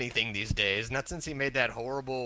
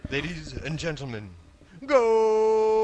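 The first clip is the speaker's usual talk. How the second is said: mock announcer; 4 semitones higher, 5 dB louder, slower than usual. Listener mimics announcer, drawn-out call.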